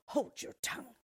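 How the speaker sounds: high, hissing witch snap; witch shouting. A narrator's reading. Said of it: "shouted the witch".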